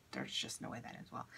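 There's just no way that ends well breathed out in a low, soft whisper; whispered aside. Real, not acted. Joking to viewers about cutting her own hair, in a low whisper. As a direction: drop into a hushed whisper to yourself.

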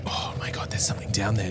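hushed whisper of sudden discovery; creeped-out whisper. Real, not acted. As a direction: whisper it so nothing hears. Spotting something spooky, whispers.

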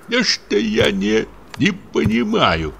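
slow, agitated muttered curse; angry muttering. A narrator's reading. Sexton mutters curses at his wife, slow. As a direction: mutter it slowly, cursing her. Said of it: "забормотал он".

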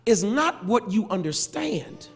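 ordinary delivery

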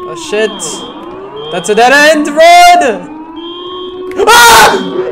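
high, slow frightened yell; panicking gamer. Real, not acted. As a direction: scream it as you flee. Chased by game monster.

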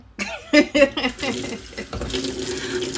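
airy, voiceless giggle; breathy laugh. Real, not acted. Laughs at own coughing.

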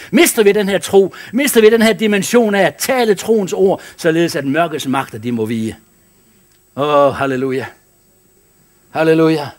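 rising into loud high preaching. Acted. Pastor urging the congregation to keep faith, building preaching. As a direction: climb until you're calling it out to the church.

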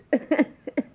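high loud laugh; real bright burst of laughter. Real, not acted. Laughing at own story.

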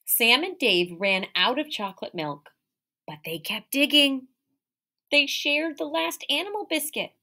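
lively, expressive read-aloud; animated picture-book reading; reading to children. Acted. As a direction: read it like storytime for little kids. Teacher reads picture book aloud.

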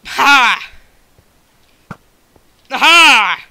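loud, high "ha!" yell; battle cry. Real, not acted. Gamer attacking opponents, loud battle cries. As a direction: yell with each strike.

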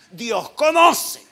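loud, high, drawn-out mock protest; mock protesting member. Pastor imitating a member excusing himself, loud mock protest. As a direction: shout it slowly as someone else's excuse. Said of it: "Hay uno que dice".

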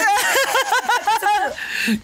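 high-pitched laughing fit. Real, not acted. Player cracking up at his own game, high laugh. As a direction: burst into long, high laughter.

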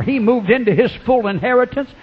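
ordinary talk

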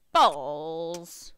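ordinary delivery

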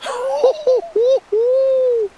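very high hooting laughter; giddy, high celebratory hoots; elated whooping laugh. Real, not acted. Celebrates landing the fish.

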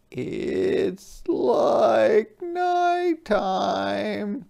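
slow, tearful wail; crying. Acted. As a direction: cry it out in sorrow. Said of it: "cried the sea urchin".

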